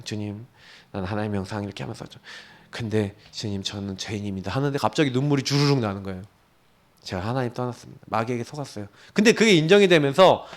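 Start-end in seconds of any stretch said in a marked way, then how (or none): 0.1-4.5 hushed prayer. Retold or imitated. Retold tearful prayer to God. 7.0-8.9 hushed confession. Retold or imitated. Retold tearful prayer confession.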